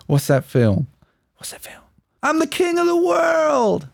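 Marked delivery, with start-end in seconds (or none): 1.4-1.8 barely audible whispered quiz question; hushed catchphrase. Pretend. Same catchphrase repeated as a whisper. 2.2-3.9 very high, drawn-out movie yell; acted triumphant shout. Shouting out a famous film quote.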